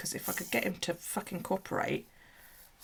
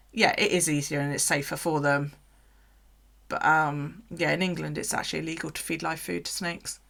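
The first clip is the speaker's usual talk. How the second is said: usual speaking voice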